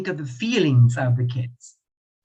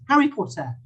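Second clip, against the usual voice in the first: English pronunciation; 5 semitones higher, faster than usual. Shown as a demonstration. Teacher showing the class the English way, British accent. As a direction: say "Harry Potter" the British English way. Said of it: "So in English".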